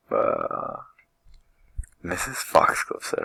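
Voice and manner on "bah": ordinary delivery, nothing marked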